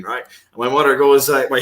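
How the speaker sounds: usual speaking voice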